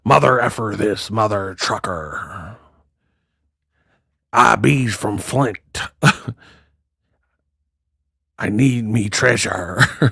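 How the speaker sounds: low, slow cussing pirate. Retold or imitated. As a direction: curse like a pirate. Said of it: "cuss like a pirate".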